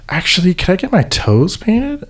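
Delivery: high-pitched polite request; lightly imitated child's hopeful question; imitated young girl. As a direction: voice a girl asking for a favor. Voicing the girl's request.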